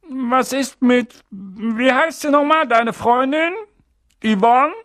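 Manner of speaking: high, loud and curt, brushing him off; Aunt Petunia's high, brusque voice; gruff aunt. Acted. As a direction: snap it out like a bossy aunt. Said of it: "sagte Tante Petunia barsch".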